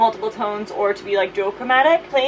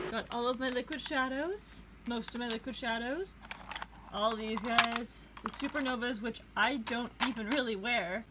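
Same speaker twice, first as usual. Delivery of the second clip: into a fan; 16 dB quieter than usual. Real, not acted. I apologize about the fan noise.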